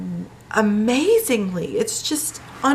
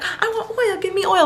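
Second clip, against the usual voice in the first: put-on much higher, bright little-kid voice for the daughter; happy toddler; 11 semitones higher than usual. Mom imitating her happy toddler demanding oil, high and eager. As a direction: chirp it like an eager two-year-old. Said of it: "If she's happy and joyful".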